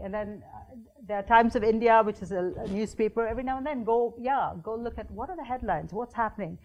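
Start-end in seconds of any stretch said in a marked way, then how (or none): none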